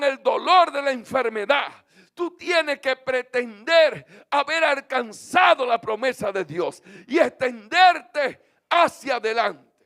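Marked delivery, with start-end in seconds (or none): none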